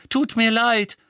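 high, loud whine; wailing apology. A narrator's reading. Tonks sprawled on the floor apologising, wailing. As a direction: wail that you are sorry. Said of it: "jammerte sie".

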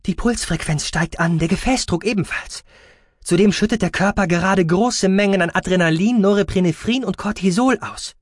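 high-pitched, officious doctor; urgent medic. Acted. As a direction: report the readings with rising urgency. Doctor urgently reports vital signs.

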